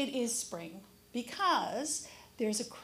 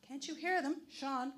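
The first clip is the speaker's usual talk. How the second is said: ordinary talk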